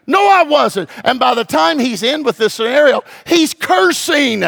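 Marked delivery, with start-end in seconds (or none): 0.1-0.9 high, loud, forceful denial; strong man's loud blunt denial; big tough fisherman. Retold or imitated. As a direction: bark it like a burly fisherman. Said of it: "big, strong, tough Apostle Peter".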